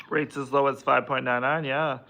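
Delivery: normal talking voice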